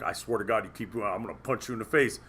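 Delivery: low, quiet mock threat; rushed low warning, held down in volume; retold threat. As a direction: imitate a fed-up buddy's warning. Imitating angry buddy's threat.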